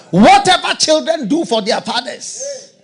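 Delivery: very high, loud, drawn-out cry; full-force preaching. Real, not acted. Preacher proclaiming to congregation, loud high cry. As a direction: cry out the promise.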